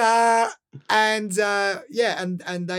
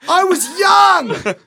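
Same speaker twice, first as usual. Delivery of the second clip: loud retelling; 7 semitones higher, 7 dB louder than usual. Real, not acted. Loud excited storytelling.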